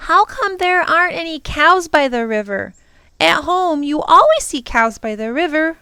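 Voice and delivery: put-on high-pitched child's wondering question; idly curious child. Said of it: "And Lindy said idly".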